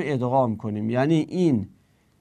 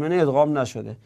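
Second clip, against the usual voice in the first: usual speaking voice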